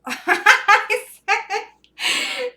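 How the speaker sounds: laughing hard while saying it; words squeezed out through a real laugh. Real, not acted. Laughing at own horse prank.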